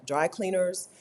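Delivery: normal talking voice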